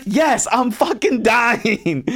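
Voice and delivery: high, loud drawn-out wail, slowed; desperate plea. Retold or imitated. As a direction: beg for rescue at the top of your voice. Overwhelmed cook pleading for help.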